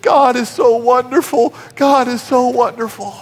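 high-pitched imitation of tearful awe; retold weeping praise. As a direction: mimic a girl overcome while praising God. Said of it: "she starts crying and she's weeping".

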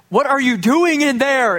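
mock frustrated friend calling through a door. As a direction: call through the door, getting annoyed.

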